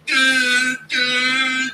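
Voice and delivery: loud high yelped syllables; mock fighter grunts. Mocking fighters debating with loud high yelps. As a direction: bark two silly high yelps. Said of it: "Impression of Gaethje and Elkins debating".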